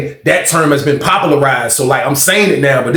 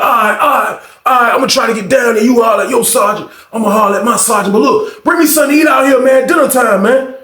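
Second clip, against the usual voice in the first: aggressive voice; 7 semitones higher than usual. Retold or imitated. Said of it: "had an aggressive voice".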